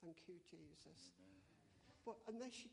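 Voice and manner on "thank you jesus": softer and slow, almost under the breath; a hushed, slow thank you; said very quietly. Retold or imitated. The healed lady quietly thanking Jesus, soft and slow. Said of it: "she said it very quietly".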